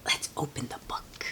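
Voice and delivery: hushed whisper to the listener; soft storytime whisper. Real, not acted. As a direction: lean in and whisper it.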